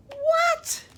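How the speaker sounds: high, loud startled cry; shocked. Acted. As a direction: squawk in disbelief. Reader shocked by lyrics.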